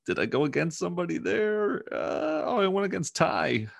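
surprised. Real, not acted. Realizes he bet against cohost.

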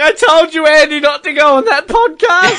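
high-pitched, laughing, tearful mock voice; mock crying athlete. A crying skier scolding her sister, in a high laughing whine. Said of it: "she's crying 'cause she's like".

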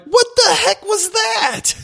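high, slow stunned cry; be floored by the attack; shocked disbelief. Retold or imitated. Retold shock at being destroyed.

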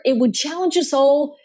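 ordinary talk

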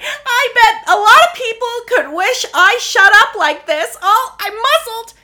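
very high, loud, whiny put-on voice; imitating Lisa. Mocking Lisa's spa joke with her face covered, whining. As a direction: whine it through a mask of goo. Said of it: "she's like".

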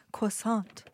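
"croissant" drawn out as kwa-SON; French-style pronunciation. Retold or imitated. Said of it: "like when people say".

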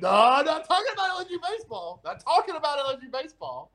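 high, fast mocking imitation of his denial; teasing the co-host by copying his excuse. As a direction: rattle off his denial as a parody. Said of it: "You're like".

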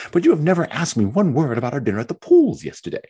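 fretful self-pitying young wife, lightly voiced; Mary's complaining voice. Mary complains to Anne about being left alone, lightly whiny.

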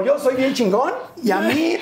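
high, drawn-out mock bragging; mock arrogant audience. Rude rich audience bragging to the host, mock arrogant. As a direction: puff it up like a rude rich spectator. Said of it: "O sea, eran como".

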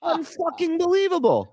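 high astonished exclamation; disbelief. Real, not acted. Disbelief at guest's lip purse.

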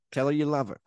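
lightly voiced mom; a hint of a mother's voice. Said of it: "you did an impression of my mom's voice".

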